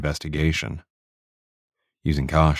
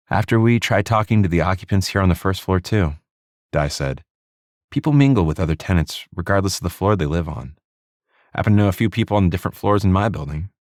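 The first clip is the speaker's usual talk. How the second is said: female detective voice; 4 semitones higher than usual. Acted. Narrator voices Di.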